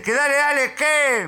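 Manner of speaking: high-pitched angry repeat; heated retold challenge; exasperated mock yell. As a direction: repeat it forcefully, out of patience. Stranger repeats challenge louder.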